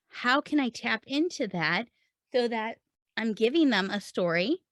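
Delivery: ordinary talk, nothing marked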